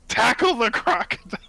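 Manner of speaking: high, excited mock scream; playful battle scream. Player to undead minions while feather falling, mock scream. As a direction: scream it out like a silly mid-air battle cry. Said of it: "I can just scream".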